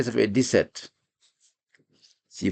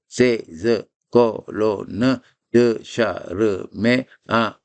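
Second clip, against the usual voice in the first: slow, each syllable drawn apart; very slow syllable-by-syllable recital; much slower than usual. Shown as a demonstration. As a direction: dictate it one syllable at a time. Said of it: "on sépare les syllabes".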